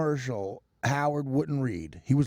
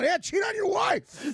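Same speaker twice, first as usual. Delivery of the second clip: much higher, slow sarcastic shout; mock shout; slower than usual. Mocking Howard over the Ashley Madison read, shouting. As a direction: yell it out teasingly. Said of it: "I'm like".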